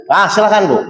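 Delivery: normal talking voice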